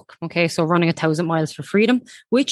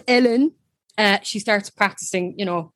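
normal talking voice

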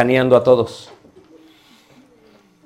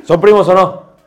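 loud challenge; 6 semitones higher, 7 dB louder than usual. Real, not acted. Teacher challenging student.

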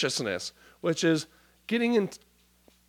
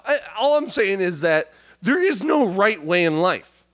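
ordinary talk, nothing marked

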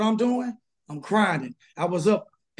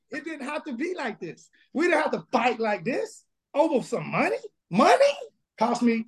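higher-pitched, incredulous protest over money; exasperated venting; 5 semitones higher than usual. Real, not acted. Divorced pastor lamenting the money it cost, exasperated. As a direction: vent it, pitch jumping up on the key words.

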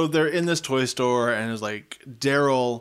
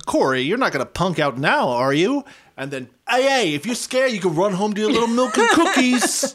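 a hint of an Italian wise guy accent, rising and louder, laughing; mock Italian wise guy accent; 6 semitones higher, 3 dB louder than usual. Quoting Daryl taunting Corey, in a light Italian wise guy accent. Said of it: "Am I making it sound like an Italian accent".

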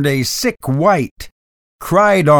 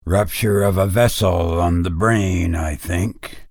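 lower, calm clinical tone; doctor voice; 8 semitones lower than usual. A narrator's reading. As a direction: diagnose coolly.

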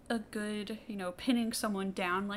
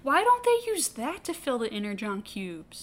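joking shout; 3 semitones higher, 8 dB louder than usual. Real, not acted. Joking outburst.

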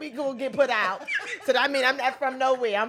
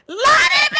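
loud excited welcome; excited shout; 8 dB louder than usual. Real, not acted. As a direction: shout his name with joy. Host welcoming guest loudly.

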